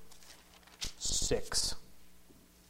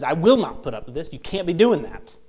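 firm, louder acted scolding; exasperated owner's telling-off, lightly acted; mock chewing-out; 3 semitones higher, 7 dB louder than usual. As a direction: lay down the law in mock anger. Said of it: "starts chewing that parrot out".

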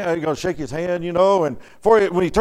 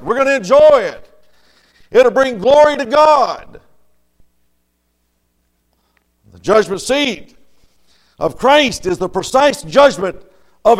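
full-force preaching; 5 semitones higher, 6 dB louder than usual. Acted. Pastor rousing the congregation about heaven, full-force preaching. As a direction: build it up and hammer each phrase home.

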